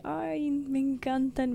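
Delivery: entusiasmo lento. Retold or imitated. Se cita con entusiasmo.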